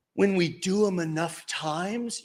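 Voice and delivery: ordinary delivery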